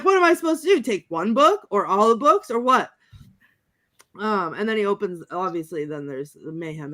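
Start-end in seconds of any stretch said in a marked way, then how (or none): 0.0-2.9 high-pitched bewildered question in imitation; mock confusion. Ash wonders which book to take, mock confusion. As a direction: ask it as if hopelessly stumped. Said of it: "why he's so confused".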